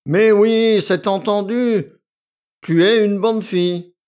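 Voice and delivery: loud, high, smug approval; self-satisfied. A narrator's reading. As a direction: praise her with a satisfied smile. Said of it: "avec un sourire satisfait".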